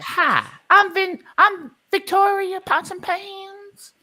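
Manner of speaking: high, loud sassy woman character. Acted. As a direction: pitch way up into a bold auntie.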